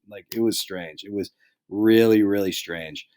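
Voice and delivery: usual speaking voice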